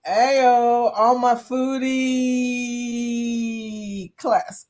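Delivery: long drawn-out hype call; hammed-up shout-out. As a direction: stretch the vowels way out, playfully. Hams up a chat message.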